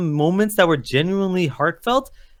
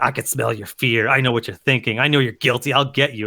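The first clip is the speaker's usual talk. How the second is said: lower, menacing monster growl; scary horror Hulk; 7 semitones lower than usual. Retold or imitated. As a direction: talk like a horror-movie Hulk stalking you. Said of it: "it's like a horror movie".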